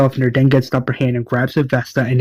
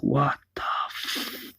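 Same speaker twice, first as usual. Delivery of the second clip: cut-off whisper of disbelief; whispered curse; 12 dB quieter, slower than usual. Retold or imitated. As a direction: breathe the curse, stop short. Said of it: "Michonne's like".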